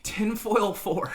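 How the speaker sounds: ordinary talk, nothing marked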